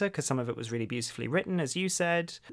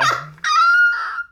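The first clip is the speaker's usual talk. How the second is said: high, loud laugh; burst of laughter; 10 dB louder than usual. Real, not acted. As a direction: crack up at your own joke. Laughs at own joke.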